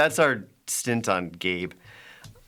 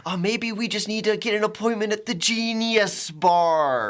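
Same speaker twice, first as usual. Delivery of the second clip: high, slow nerdy voice; nerdy tech guy; 10 semitones higher than usual. Retold or imitated. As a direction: play the smug tech nerd. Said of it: "and he's like".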